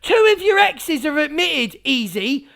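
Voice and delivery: ordinary talk, nothing marked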